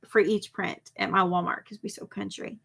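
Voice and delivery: ordinary delivery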